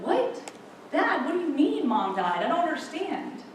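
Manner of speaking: high, stunned questioning, acted out; shocked disbelief. Daughter hears her mother has died, shocked and bewildered. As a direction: plead for sense in rising disbelief.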